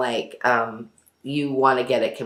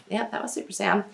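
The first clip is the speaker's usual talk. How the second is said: normal talking voice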